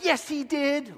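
put-on high, sulky teenage retort; like a teenager. Luke answering Vader, high teenage retort. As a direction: snap back like a teen. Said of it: "like a teenager".

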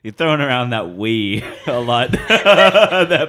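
slow, loud, laughing teasing; laughing tease. Real, not acted. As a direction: rib him while laughing hard. Teasing the GM's "we.

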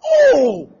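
loud, high imitation of onlookers; a big mock-stunned "oh"; crowd's shocked cry. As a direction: react like a whole crowd gasping. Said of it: "the way people say".

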